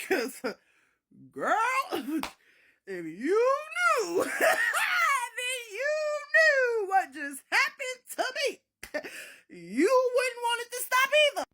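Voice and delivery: high, loud wail broken by laughter; laughing protest. Retold or imitated. Narrator confessing to granny they enjoyed it, laughing wail. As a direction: drag it out, cracking up as you plead.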